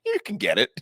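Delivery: high-pitched mock concession, near laughing; high comic retort. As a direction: squeak out the retort playfully. Comic retort to officer, retold.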